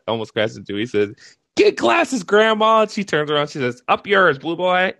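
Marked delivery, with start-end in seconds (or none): 1.5-2.8 high, louder angry yell. Retold or imitated. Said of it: "he yells at this old lady". 3.9-4.9 put-on high, sassy old-lady retort; feisty grandma. Grandma snapping back.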